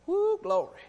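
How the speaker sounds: high joyful woo; excited whoop. Real, not acted. Preacher whooping as he gets excited, joyful shout. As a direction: let the joy burst out. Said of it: "but I'm getting excited".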